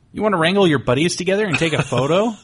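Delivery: eager ask. Retold or imitated. Fan asking cosplayers for a group photo, eager and laughing. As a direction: rush the request with a laugh. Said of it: "I was like".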